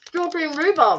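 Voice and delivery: talking with a mouthful; words round a bite of food; mouth full. Real, not acted. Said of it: "Try not to eat your mouth full".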